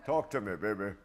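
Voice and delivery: loud, fast hip street voice; cool street-guy reply. Retold or imitated. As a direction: play it cool and cocky, teasing the caller. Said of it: "I say".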